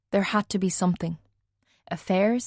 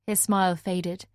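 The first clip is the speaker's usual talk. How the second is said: plain reading voice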